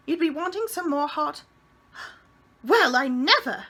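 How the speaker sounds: scandalised gasp of a prim landlady; shocked landlady. A narrator's reading. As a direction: Miss Persimmon aghast at guests in the air. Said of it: "she said".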